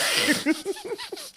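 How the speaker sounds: bursting high-pitched laugh; a sudden squealing cackle. Real, not acted. Laughing at the show's plot.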